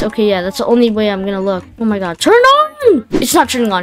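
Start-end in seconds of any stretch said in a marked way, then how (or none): 2.2-3.1 desperate yelp. Real, not acted. Lights fail again.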